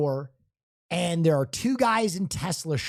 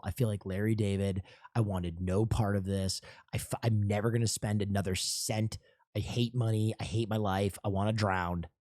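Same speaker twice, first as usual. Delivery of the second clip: much lower, softer mournful moaning; despairing whine; 11 semitones lower, 9 dB quieter than usual. Retold or imitated. Telling his friend about the embarrassment, low mournful moan. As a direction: moan it out low like your life is over.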